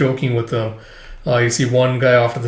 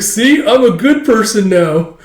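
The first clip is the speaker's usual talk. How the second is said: much higher, louder, slow boast; smug boast; 9 semitones higher, 6 dB louder, slower than usual. Retold or imitated. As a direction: preen slowly. Smug reformed senior.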